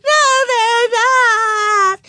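high, very slow loud wail; drawn-out childish crying. A narrator's reading. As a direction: bawl the denial like a small child. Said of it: "cried Susan".

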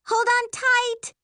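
high, loud drawn-out warning; yell. A narrator's reading. Sister warning passenger before crash, yelling. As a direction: shout it long and high. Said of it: "Miri yells".

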